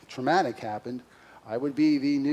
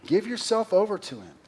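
raised, pleading call to surrender; fervent exhortation; 8 semitones higher, 5 dB louder than usual. Real, not acted. Pastor urging his congregation, raised fervent plea. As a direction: lift the voice and urge them to give themselves over.

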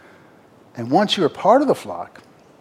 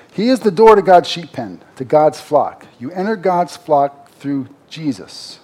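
emphatic proclamation; 3 semitones higher, 8 dB louder than usual. Acted. Preacher to congregation, loudly insisting Jesus is the door. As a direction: proclaim it like the central truth. Preacher driving home key point.

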